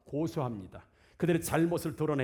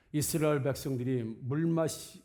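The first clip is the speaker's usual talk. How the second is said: ordinary delivery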